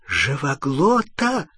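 slow, effortful questioning croak; strained wheeze. A narrator's reading. Hermione, winded, answers Ron, strained wheeze. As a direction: struggle the word out, doubled over. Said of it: "с трудом прохрипела согнувшаяся пополам Гермиона".

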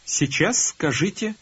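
usual speaking voice

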